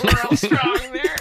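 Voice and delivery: speaking through leftover laughter; real giggles bubbling under the words; giggle fit. Real, not acted. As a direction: confess it while still laughing. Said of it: "Got a case of the giggles".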